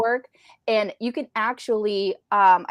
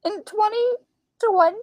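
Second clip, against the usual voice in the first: much higher, stunned disbelieving question; can't believe the year she's reading; a stunned high repeat of the year; incredulous; 12 semitones higher, 4 dB louder than usual. Real, not acted. Disbelief at the article's date.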